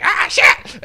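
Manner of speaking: high loud startled yelp; mock panic. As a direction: cry out as the gun comes out. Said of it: "the other one's just like".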